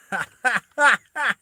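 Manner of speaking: high, loud cackling burst; acted laugh. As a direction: laugh hard as the character. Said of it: "he starts laughing".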